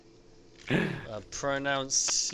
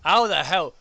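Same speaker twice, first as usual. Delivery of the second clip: exasperated surprise; 3 semitones higher, 10 dB louder than usual. Real, not acted. Puzzling over the flag name.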